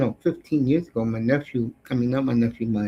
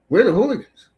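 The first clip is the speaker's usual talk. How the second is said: louder, higher rallying boast; proud shout; 7 semitones higher, 6 dB louder, faster than usual. Acted. Boasting for English hooligans to rival fans, loud proud shout. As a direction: chant it like a fan in the stands.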